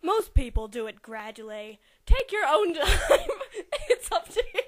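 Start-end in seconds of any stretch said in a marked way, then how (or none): 2.1-4.6 laughing. Real, not acted. Laughing while reading.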